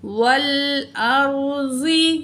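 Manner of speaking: والارضی drawn out syllable by syllable; slow letter-by-letter reading. Shown as a demonstration. Teacher spelling out the word for students, slow. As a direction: read والارضی very slowly. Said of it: "زود زیر زی".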